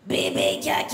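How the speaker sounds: put-on very low, rough baby words; drunkard voice. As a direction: croak it like an old drunk. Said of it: "mes premiers mots".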